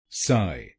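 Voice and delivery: साय said alone as "sai"; साय as a clean separate "sai"; first syllable. Shown as a demonstration. Teacher isolating the first syllable, साय as "sai". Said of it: "बोलिए साय".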